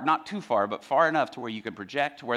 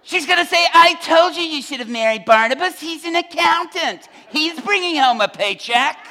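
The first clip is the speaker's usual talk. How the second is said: high, loud, wailing complaint; exasperated rant building to a drawn-out shout; Peter hollering; 14 semitones higher, 12 dB louder than usual. Acted. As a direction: holler your misery, stretching the final outburst. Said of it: "while he's hollering at his crew".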